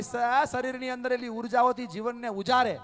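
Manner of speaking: loud, high preaching in full flow; fiery preaching. Acted. Speaker praising the women present, fiery preaching. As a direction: proclaim it like a sermon climax.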